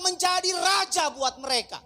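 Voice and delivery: loud, slow, ringing pulpit delivery; preaching at full force. Acted. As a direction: thunder it out to the congregation. (khotbah berapi-api dengan suara lantang)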